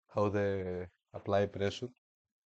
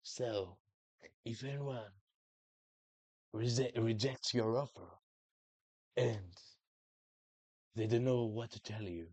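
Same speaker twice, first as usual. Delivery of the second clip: softer, lightly voiced mafia boss; a hint of a mob boss giving advice; mafia boss impression; 6 dB quieter than usual. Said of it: "he talks like this".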